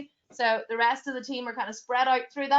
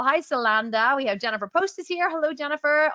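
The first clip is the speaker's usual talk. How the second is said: ordinary talk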